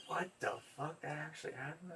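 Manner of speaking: hushed, high, amazed aside; retold stunned disbelief. Retold stunned reaction to tip.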